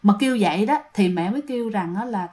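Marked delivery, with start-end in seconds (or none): none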